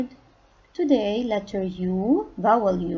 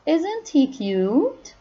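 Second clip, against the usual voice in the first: cooing; 6 semitones higher, 3 dB louder, slower than usual. Acted. Cooing over a cute picture.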